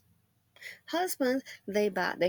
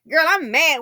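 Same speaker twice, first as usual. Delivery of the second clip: mock mad; 3 semitones higher, 7 dB louder than usual. Acted complaining friend.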